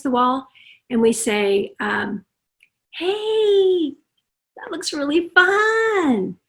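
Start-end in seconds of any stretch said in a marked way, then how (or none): 2.9-6.4 high, slow, friendly coaxing; bright friendly parent. Acted. Parent to child with a truck, high friendly coaxing. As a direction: sound delighted by the toy.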